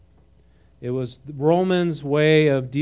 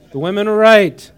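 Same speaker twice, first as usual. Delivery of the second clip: triumphant; 5 semitones higher, 11 dB louder than usual. Real, not acted.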